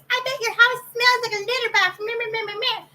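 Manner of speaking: high, sing-song put-on voice trailing into meh-meh; mocking critic. Cat owner imitating people who judge the house, mocking. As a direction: ridicule the nosy commenter in a silly high voice. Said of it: "I always chuckle when somebody goes".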